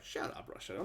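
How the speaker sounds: a soft whispered shut up; whispered dismissal. Retold or imitated. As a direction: whisper it, scornful. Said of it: "it's like".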